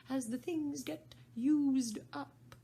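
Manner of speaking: slow, high Hatter. Acted. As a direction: explain it as the Hatter. Hatter voiced in story.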